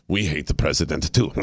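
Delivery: a lightly voiced snooty French gallery-goer; snotty French accent. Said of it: "'Cause they're like snotty French people".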